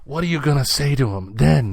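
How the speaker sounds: usual speaking voice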